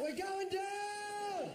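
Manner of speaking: hammed-up terror, dragging every word; panicked yell. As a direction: scream it like the helicopter is falling. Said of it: "And yelling out".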